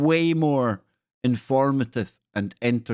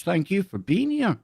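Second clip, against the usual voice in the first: usual speaking voice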